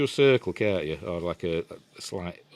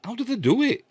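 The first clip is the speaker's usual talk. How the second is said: amazed; 5 dB louder than usual. Retold or imitated. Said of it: "And I'm like".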